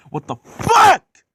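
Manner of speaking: high, loud, drawn-out scream on the curse; furious outburst. Acted. Henry fuming at having to shunt coaches, furious scream. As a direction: explode with rage, screaming the last word. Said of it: "they were very fucking mad".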